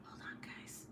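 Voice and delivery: whispered aside. Real, not acted. Vlogger pausing for her viewers, whispering. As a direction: breathe it to the viewers. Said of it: "aside to viewers".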